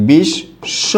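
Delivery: বিশ্ব said as bishsho, the sh doubled; doubled consonant. Shown as a demonstration. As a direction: hold the sh in বিশ্ব twice, bish-sho. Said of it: "এটার উচ্চারণ কিন্তু ঠিক same".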